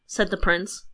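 ordinary delivery

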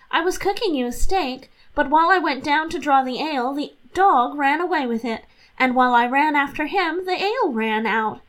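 lightly voiced guileless young wife; Catherine's innocent voice. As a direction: rattle off the excuses innocently. Catherine explains to her husband.

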